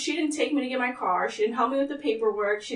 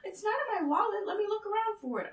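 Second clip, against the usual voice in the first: mocking mom; 11 semitones higher, 5 dB quieter, faster than usual. Mom's quote, high.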